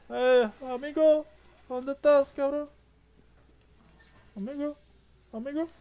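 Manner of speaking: high taunting call of amigo; mock Spanish calling. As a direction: call out like a mocking bandit. Mocking Spanish calls to villagers.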